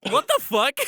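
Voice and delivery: loud, high shocked outburst; stunned disbelief, shouting. Real, not acted. Reacting to penis story.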